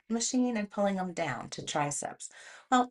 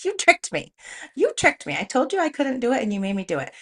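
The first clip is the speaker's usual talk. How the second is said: much higher and louder, fast indignant outburst; mock outrage; 5 semitones higher, 8 dB louder, faster than usual. Yelling at the trainer for tricking her into twelve reps, mock outrage. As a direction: play-act being furious at being tricked. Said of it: "frustrated the whole time".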